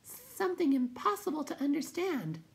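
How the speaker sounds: very high, tiny creature voice; halting. A narrator's reading. Will-o'-the-Wisp explains the trouble, halting high voice. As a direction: pipe it out haltingly. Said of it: "said the Will-o'-the-Wisp haltingly".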